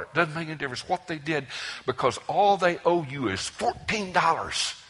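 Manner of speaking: high and fast, building; rising fervor. Real, not acted. Escalating preaching point.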